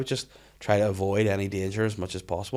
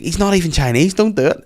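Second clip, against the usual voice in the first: high, loud exasperated protest; objecting inner voice; 8 semitones higher, 7 dB louder than usual. Acted. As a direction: plead against it, pitched up and forceful. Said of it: "the other voice was like".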